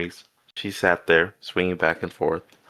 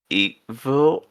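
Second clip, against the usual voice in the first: a rising call, higher and louder; 6 semitones higher, 3 dB louder than usual. A narrator's reading. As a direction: call her name through the rain. Calling out in the storm.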